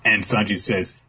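ordinary delivery

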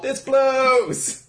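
high, drawn-out complaint; mock outrage. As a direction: wail it in exaggerated disgust. Acts out disgust at the show.

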